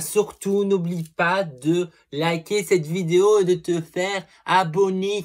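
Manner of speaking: high, loud hype-man push to subscribe; hyped subscribe plea. Pretend. Loud, high hype pitch to viewers to subscribe. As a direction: sell the subscribe like a showy host.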